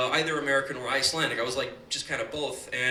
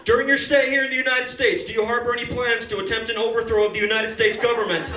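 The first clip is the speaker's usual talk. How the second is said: interrogating officer; 5 semitones higher, 3 dB louder than usual. Retold or imitated. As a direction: grill the kid with deadpan official questions. Officer asks interrogation questions.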